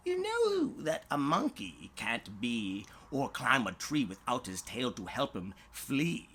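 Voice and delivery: usual speaking voice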